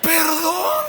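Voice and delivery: loud, high, hammed-up apology; disculpa exclamada. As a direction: cry out a flustered doorman's apology. Portero apenado pide perdón. (disculpa exagerada, fuerte y aguda)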